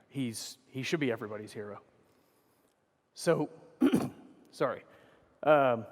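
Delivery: ordinary talk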